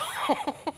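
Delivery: airy unvoiced laugh; quiet breathy chuckle; breathy giggle. Real, not acted. Breathy laugh after joke.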